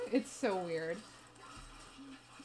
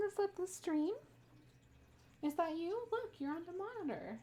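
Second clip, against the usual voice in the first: high sweet pet talk; 9 semitones higher than usual. Real, not acted. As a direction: coo at the cat, delighted. Showing cat the stream.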